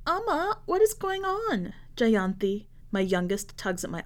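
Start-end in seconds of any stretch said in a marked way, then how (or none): 0.1-1.7 put-on much higher small child's voice; a puzzled little girl. Youngest daughter tugs at mother asking what is happening.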